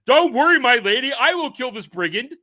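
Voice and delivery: high, loud mock-gallant rescuer; gallant sorcerer voice. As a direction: play the chivalrous wizard, high and loud. Dream NPC voiced, high and loud.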